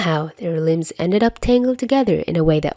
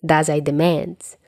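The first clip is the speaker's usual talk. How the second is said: plain reading voice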